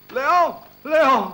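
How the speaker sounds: loud, high call of a name. Acted. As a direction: shout out to get his attention. Calling out for Leo. (richiamo gridato e acuto)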